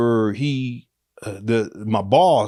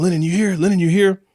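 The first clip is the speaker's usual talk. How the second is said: higher, louder searching call; 7 semitones higher, 4 dB louder than usual. Retold or imitated. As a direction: call for someone hiding in the room. Boss calling inside the apartment.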